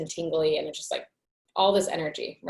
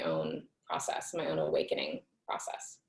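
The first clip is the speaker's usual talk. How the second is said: whispered aside; 13 semitones lower, 8 dB quieter than usual. Real, not acted. Trailing whisper.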